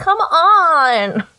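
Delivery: high, loud frustrated yell; mimicking a daughter's whiny outburst; tantrum shout. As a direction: throw the words like a toddler in a fit. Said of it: "shakes them like a toddler having a temper tantrum".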